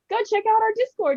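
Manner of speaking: squeaky imitation of a friendly server; high mock voice. Quoting the group invite.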